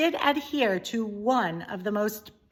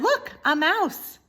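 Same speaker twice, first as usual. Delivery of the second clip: put-on excited young princess; 10 semitones higher, 5 dB louder, slower than usual. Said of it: "she said".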